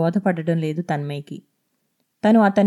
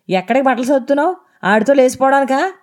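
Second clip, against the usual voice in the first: fast, loud, much higher jeer; fast angry accusation; 6 semitones higher, 4 dB louder, faster than usual. A narrator's reading. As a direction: fire the accusation at her quickly. Drunk husband accuses her of leaving.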